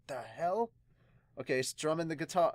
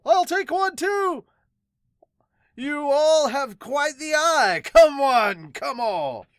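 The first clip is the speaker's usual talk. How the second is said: high, loud, showy carnival barker; loud hawking salesman; 7 semitones higher, 10 dB louder, slower than usual. Acted. Usopp as an oil salesman hawking to onlookers, loud barker. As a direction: sell the toad oil to the crowd at full volume.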